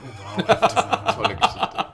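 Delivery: high, loud, slow words through laughter; real laughter breaking through the words; laughing. Real, not acted. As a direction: crack up while praising the story. Laughing at the pheasant story.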